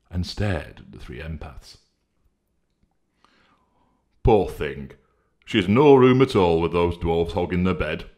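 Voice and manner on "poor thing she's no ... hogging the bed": loud pitying voice; Honesty pitying Goldenark, loud; crying out. Acted. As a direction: pity her, out loud. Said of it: "cried Honesty".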